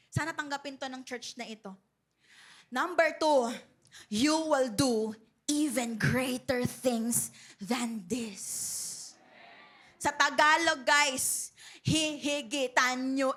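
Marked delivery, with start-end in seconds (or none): 4.1-9.2 solemn reading. Acted.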